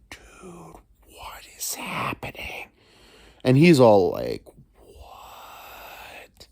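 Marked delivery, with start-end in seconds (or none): 0.1-2.7 slow high whispered stoned dog; slow dazed whisper. Acted. 4.9-6.5 slow high whispered stoned dog; slow dazed whisper. Acted.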